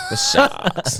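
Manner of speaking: high squeaky mock Boston drawl on The sharks; mock Boston accent. Hammed-up Boston accent. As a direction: friend imitating a Boston mom, squeaky Boston accent. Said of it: "Boston accent".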